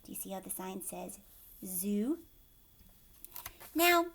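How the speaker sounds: usual speaking voice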